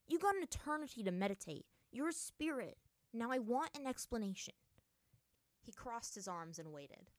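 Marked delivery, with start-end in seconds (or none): 0.1-4.5 loud, higher-pitched scolding; harsh demand. A narrator's reading. Aang demands explanation from the meditating spirit, harshly. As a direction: insist on being answered. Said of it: "Aang said to him harshly".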